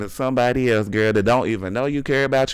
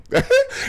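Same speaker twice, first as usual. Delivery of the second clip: high laugh. Real, not acted. Laughs at her own rant.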